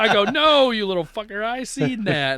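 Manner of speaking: high, loud teasing scold; playful gotcha. Retold or imitated. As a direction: tease him for faking. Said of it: "And I go".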